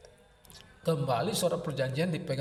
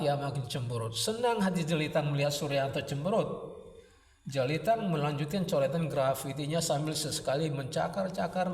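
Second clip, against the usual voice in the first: usual speaking voice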